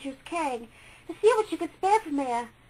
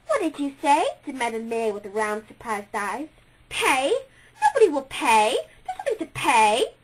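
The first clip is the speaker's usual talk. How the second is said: louder, wide-eyed astonishment; surprised; 7 dB louder than usual. A narrator's reading. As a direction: she cannot grasp the idea of paying. Maya puzzled about paying.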